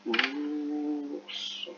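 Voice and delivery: ours stretched as ouuuur... s on a held note; slow, sustained ours; drawn-out sounding out. Shown as a demonstration. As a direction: hold the vowel of ours while writing it. Sounding out the word while writing.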